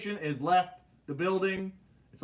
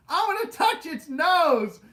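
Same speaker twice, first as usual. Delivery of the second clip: high, loud, slow gleeful sister voice; the fearless big sister daring the shark; teasing sister; 13 semitones higher, 9 dB louder, slower than usual. Retold or imitated. Sister on the ride, gleefully teasing the scared kid. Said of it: "My sister goes".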